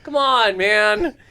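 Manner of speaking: high, whiny-edged mock plea; pleading. Bombing comic begging a heckler to stop, pleading. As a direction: beg with rising desperation. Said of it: "I'm pleading with this guy".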